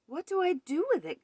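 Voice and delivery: high, helpless question; flustered cry. A narrator's reading. Gus finds a baby ghost on the step, flustered cry. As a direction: cry out at a loss. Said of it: "Gus cried".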